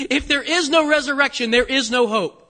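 loud, insistent proclamation; emphatic repeat. Real, not acted. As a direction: drive it home to the congregation. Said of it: "Do you hear me?".

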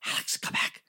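whispered call to come back; hushed call. Retold or imitated. At first whispering to teammate to come back. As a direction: hiss his name to call him back. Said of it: "at first I was like".

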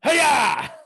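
high, loud hammed-up hero; heroic battle cry. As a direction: ham up a hero's big moment. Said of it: "you're like".

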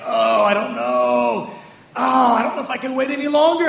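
a hint of hammed-up despair; mock despair. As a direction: moan it like you're worn out, with a chuckle. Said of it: "the next minute you're like".

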